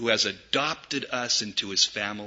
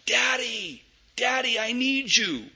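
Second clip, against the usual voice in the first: put-on high little child calling daddy; a child calling for daddy, needy; a small child calling for his father; child's cry; 11 semitones higher than usual. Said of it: "the cry of that child".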